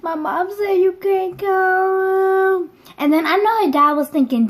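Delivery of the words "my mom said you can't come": high, drawn-out tearful wail; mock crying. Tearfully turning a friend away at the door, mock crying. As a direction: whine it slowly through pretend sobs. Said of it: "I was crying".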